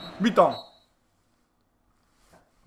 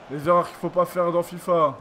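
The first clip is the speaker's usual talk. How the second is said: normal talking voice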